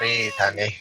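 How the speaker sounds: soft, low calming voice; gently calm her down; soothing. Real, not acted. Calming partner.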